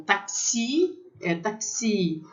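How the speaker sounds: taxi with the weight on the last syllable, tak-SI; final-syllable stress. Shown as a demonstration. Teacher shows French words stressed at the end, final stress. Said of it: "toda palavra é acentuada no final".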